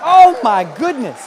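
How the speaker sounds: a loud, drawn-out gasp of wonder; awed amazement. Retold or imitated. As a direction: stretch it out in astonishment. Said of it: "I was like".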